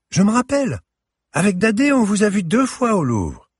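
put-on high, eager child's voice; excited little girl. A narrator's reading. Girl tells the curator she saw her at the Louvre: excited child. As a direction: chatter like a delighted girl.

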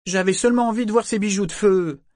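put-on high, loud girlish pleading; a teenage girl, eager to explain; young girl voice. A narrator's reading. Girl explains to her elders.

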